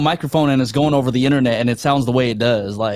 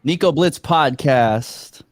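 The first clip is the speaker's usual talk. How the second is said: slow, deliberate host intro; slow announcement; much slower than usual. Real, not acted. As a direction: announce the segment slowly, spacing out the phrases. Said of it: "I'm gonna say this slowly".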